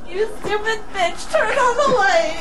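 high, loud frantic cry with laughter; frantic yell. Real, not acted. Groping for the switch, she yells at the light in panic. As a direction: scream at the light in terror.

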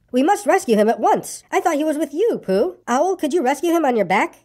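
put-on high, urgent storybook child; rally a friend to a rescue; high-pitched anxious boy voice; urgent boy character.

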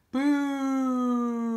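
long, high, drawn-out boo; playfully boo the losing card; mock booing.

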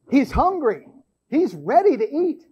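ordinary talk, nothing marked

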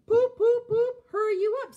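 high, loud whistling poop, then a cross order; big engine Gordon honking crossly at Thomas. A narrator's reading. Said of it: "said Gordon crossly".

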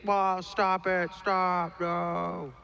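high, drawn-out pretend yell; mock alarmed shout. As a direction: cry out like an admin rushing in. Acting admins rushing the stage.

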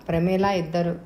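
plain reading voice

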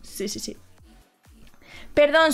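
ordinary talk

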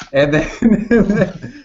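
can't hold back the laugh as you tell it. Real, not acted. Laughing while retelling the scene.

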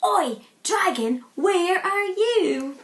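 lightly shouted "Oi, dragon", slow on "where are you"; shouting together. Acted. Vikings calling the dragon from the hilltop, mild shout. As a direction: call out across the hill to find the dragon. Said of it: "they all shouted all together".

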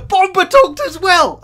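high, loud burst of excitement; delighted exclamation. Real, not acted. Viewer thrilled that Bomba talked, delighted. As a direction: shout it in happy amazement.

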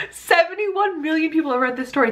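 Seventy-one million, laughed out high; laughing disbelief. Real, not acted. Amazed at the read count.

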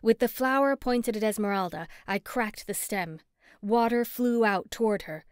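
plain reading voice

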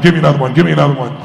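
loud, impatient demand; retold frustration. Frustrated golfer demanding another ball from instructor, acted impatience. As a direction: demand it twice, pushing harder with volume. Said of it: "getting more and more frustrated".

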